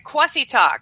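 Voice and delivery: "coffee talk" as "quawfee tawk"; hammed-up New York vowels; mock New York accent. Playful put-on name of coffee segment.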